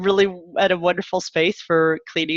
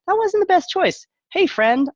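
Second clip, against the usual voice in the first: lightly voiced as the daughter, higher and louder at first; breezy owning-up, pitched up; daughter's light self-talk; 4 dB louder than usual. Mother models for her daughter how to own a choice, light and high.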